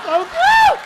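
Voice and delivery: high, loud squeal of delight; squealing so cute. Retold or imitated. Comedian gushing at the little guy, a loud high squeal. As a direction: squeal like you saw a puppy.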